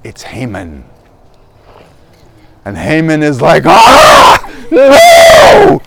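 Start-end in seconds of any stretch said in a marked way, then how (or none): 3.7-5.8 loud, high scream of dread; hammed-up horrified wail; panicked cry. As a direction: cry out as if caught and doomed. Haman exposed before the king.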